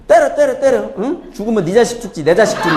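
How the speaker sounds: high-pitched cheeky defiance; defiant comeback. Acted. Little son talking back to his slapping father, defiant. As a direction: stand up to dad, daring him. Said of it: "그럼 막 대들면서".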